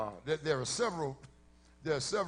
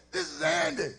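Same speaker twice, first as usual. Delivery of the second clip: higher, eager introducing voice; high-pitched woman; 9 semitones higher than usual. Retold or imitated. Woman introducing Andy to the guys, high-pitched. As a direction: announce him to the room, pitched up. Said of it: "this high-pitched voice".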